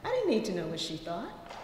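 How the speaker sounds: ordinary talk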